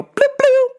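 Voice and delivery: high two-note chime sound; cartoonish bloop bloop; imitated chime. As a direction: mimic the boarding gate chime.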